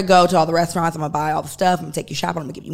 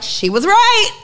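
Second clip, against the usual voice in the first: amazed realisation; 6 dB louder, much slower than usual. Retold or imitated.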